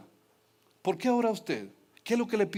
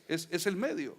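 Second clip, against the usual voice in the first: usual speaking voice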